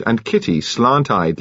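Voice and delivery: plain reading voice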